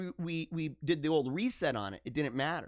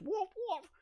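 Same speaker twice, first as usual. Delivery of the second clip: mock dog bark; 6 dB quieter than usual. Lassie barking in retold scene.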